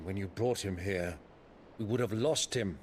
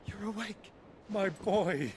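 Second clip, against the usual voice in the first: glad surprise; 10 semitones higher than usual. Acted. Uncle greeting his nephew as he wakes, glad surprise. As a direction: welcome him back, delighted.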